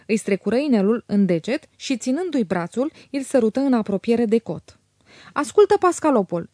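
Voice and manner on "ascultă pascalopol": higher, louder irritated snap; irritated outburst. A narrator's reading. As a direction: burst out annoyed at Pascalopol. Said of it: "izbucni Aglaia iritată". (izbucnire iritată, mai tare)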